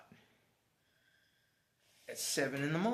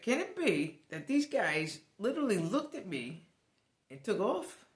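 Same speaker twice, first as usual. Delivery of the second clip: higher and louder, incredulous; disbelief; 7 semitones higher, 4 dB louder than usual. Retold or imitated. Abandoned by carpool buddies, loud incredulous disbelief. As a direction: say it like the betrayal is sinking in.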